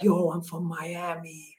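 soft, drawn-out mock American accent; quiet throwaway American impression. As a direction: lightly fake a Miami American, slow and quiet. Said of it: "I'm doing these American accents".

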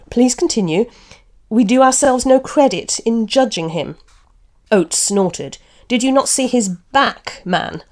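story reading with lightly voiced characters; literary reading with mild character voices; reading aloud from a novel. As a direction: read the passage like an audiobook, giving each speaker a touch of voice. Reads novel passage with voices.

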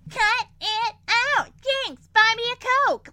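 high, mocking kid chant; exaggerated high-pitched children's taunt; goblin children. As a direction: mimic bratty kids in unison. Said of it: "four little goblin children are all like".

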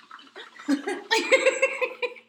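high burst of real laughter. Real, not acted. Co-host cracks up at the mock swoon, high laughter. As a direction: crack up at the mockery.

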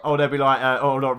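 usual speaking voice

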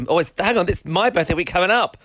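ordinary talk

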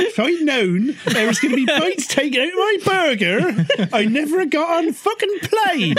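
a hammed-up West Country yokel, giggling; West Country accent. West Country yokel fumes over burger bites, half laughing.

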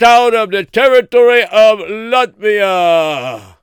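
high, loud proclamation shout; town crier. Acted. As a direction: boom the decree out like a town crier. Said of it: "And they would call out".

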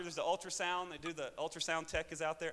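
normal talking voice

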